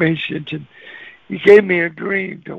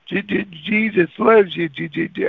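imitating a frightened stammer; mock nervous stuttering; scared stutter. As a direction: trip over the first sound of Jesus, nervously. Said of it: "I was stuttering, you know, 'cause I'm scared".

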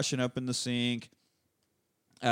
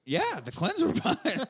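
high laughing yeah, playing along; laughing agreement; 13 semitones higher than usual. Friend confirming the silly Clinton claim, laughing. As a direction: laugh it out while confirming the joke.

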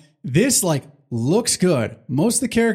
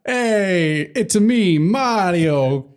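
higher, hammed-up New York Italian Mario; New York Italian accent; 4 semitones higher, much slower than usual. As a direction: shout it like a Brooklyn wiseguy. Hammy New York Mario bit.

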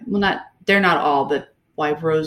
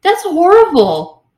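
appalled; 11 semitones higher, 9 dB louder than usual. Real, not acted. Outraged reaction to the scene.

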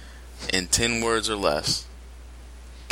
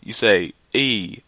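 normal talking voice

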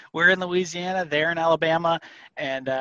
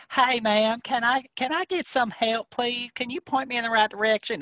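hammed-up Southern accent; 7 semitones higher than usual. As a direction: play up the Southern charm to a clerk. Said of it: "I can accent it up".

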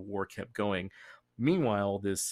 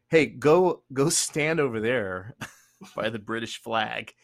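mock commander; 7 dB louder than usual. A commander ordering the envoys to the flag, mock bossy. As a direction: bark it like a smug officer giving orders. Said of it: "Donitz is like".

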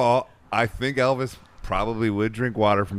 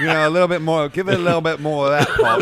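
quick, higher mock English voice through laughter; English accent; 7 semitones higher, 8 dB louder, faster than usual. Producer urging Paul for more, in English accent. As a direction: coax Paul like a London engineer. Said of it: "Did you slip into an English accent?".

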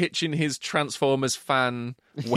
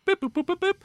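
high mock dialing tones, boop boop; phone beeps. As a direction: chirp out the ringing tone like a phone.

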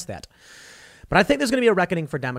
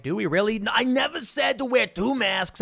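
higher, lightly put-on official voice; mocking flip-flop voice; 6 semitones higher than usual. Mocking Fauci denying the two mask advice. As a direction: play innocent, denying you ever said it. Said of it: "And then they're like".

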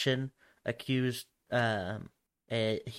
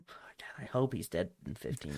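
whisper; 5 semitones lower, 9 dB quieter than usual. Real, not acted. Muttered aside about Putin.

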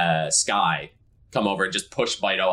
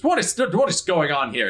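high, louder, outraged question; indignant demand; 10 semitones higher, 4 dB louder than usual. Acted. Bart bursting in, demanding what is happening. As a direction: demand an explanation.

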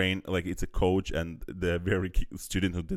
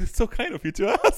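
much higher, laughing sweetly; high mock-polite voice; 13 semitones higher, 6 dB louder than usual. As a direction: play coy and overly polite. Voicing Ludwig, high laughing.

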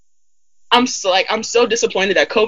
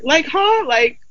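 baffled huh; 5 semitones higher than usual. Retold or imitated. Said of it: "reacting to classmates".

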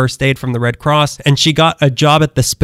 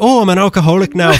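put-on a light Irish accent, a bit higher; Colin Farrell impression; 5 semitones higher, faster than usual. Joking about the dad's drinking, as a light Irish Colin Farrell. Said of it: "that's my Colin Farrell".

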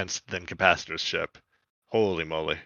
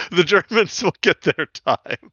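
laughing; 6 semitones higher than usual. Real, not acted. Joking reply about German accent.

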